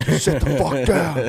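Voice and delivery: retold yell. Retold man snapping at kid.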